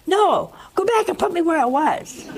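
high, loud complaint; high, loud protest at the doctor; indignant protest. Retold or imitated. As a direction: demand it like you've been cheated. Man begs to return.

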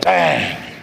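low, punched bang sound; mimicked bang. Preacher describing how hard the inner word hit him, imitated bang. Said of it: "That was like".

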